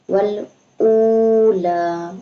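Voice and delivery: slow, drawn-out chanted recitation; tajweed recitation, slow and measured; Quran recitation. Shown as a demonstration. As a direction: recite it melodically, stretching each vowel. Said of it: "teacher recites the verse ending".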